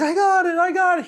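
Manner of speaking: put-on a kid screaming with joy over his catch; excited shout. Son catches the seagull, retold.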